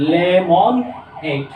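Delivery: slowly stretched. Shown as a demonstration. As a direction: say lemonade slowly for the class to copy. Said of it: "তিনবার উচ্চারণ". (Lemonade ধীরে জোর দিয়ে)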